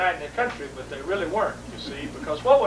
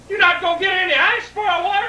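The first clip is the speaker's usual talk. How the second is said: high, loud whining question; whiny dad; 10 semitones higher, 4 dB louder than usual. Retold or imitated. Dad whining at his son about the ice, high. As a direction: whine about missing ice. Dad's demand.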